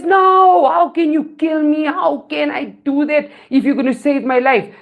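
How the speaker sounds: high, emphatic story-character protest; protesting scorpion. Acted. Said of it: "He says".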